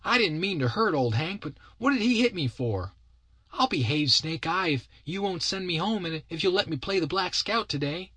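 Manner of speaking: put-on very high, protesting little boy voice; sulky pleading kid. Kid begs not to be sent home, high sulky voice.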